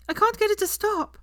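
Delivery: put-on near-shrieking panic over the baby; desperate cry. A narrator's reading. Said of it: "she cried".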